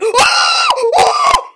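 sharp shrieking gasp of fright; loud gasp. Real, not acted. Gamer jolting at a jump scare, shrieking gasp. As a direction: gasp as the monster appears.

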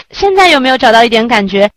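usual speaking voice

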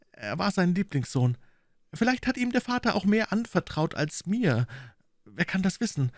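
high, quick, a bit hushed fretful voice; a nervous man explaining in the dark; Thaddeus, high and anxious; uneasy gentleman. Acted.

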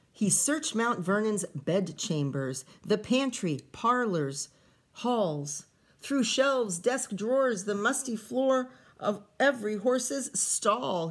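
lively read-aloud storytelling voice; story reading. A narrator's reading. Teacher reading a picture book to kids, lively storytelling. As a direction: read the rhyming story to the children.

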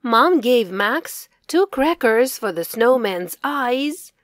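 plain reading voice

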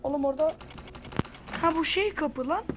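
normal talking voice

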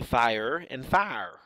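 "fire" said slowly, first standard then with a Southern vowel; two pronunciations of fire. Shown as a demonstration. Teaching a class, contrasts two pronunciations of "fire" slowly. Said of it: "she pronounces that word quite differently".

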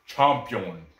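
"Champion" with an open ah, ending in yon; foreign-style pronunciation. Shown as a demonstration. Repeating a tough name to pronounce, foreign-sounding "Champion". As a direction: say "Champion" as chahm-PYOHN. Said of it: "got a tough name to pronounce".

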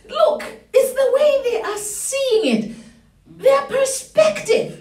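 high-pitched, excited rallying cry; fired-up preaching. Real, not acted. Preacher rallying congregation against giants, fired-up preaching. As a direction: rouse the room to fearless victory.

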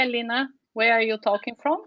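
ordinary talk

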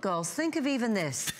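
ordinary talk, nothing marked

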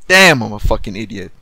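loud self-scolding; self-directed anger. Real, not acted. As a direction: curse yourself out loud. Cursing himself after bad choice.

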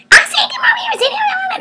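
furious parent shouting the order; angry yell. Retold or imitated. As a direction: yell at the kid in anger. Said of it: "Or we get mad".

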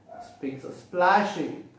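plain reading voice

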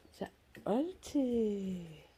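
soft whispered praise; whispering. Real, not acted. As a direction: whisper good dog. Whisper to dog.

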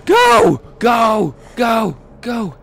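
high scream then urgent yells; real panic yelling; panicked screaming. Real, not acted. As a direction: scream and urge yourself to run. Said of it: "I screamed like a girl".

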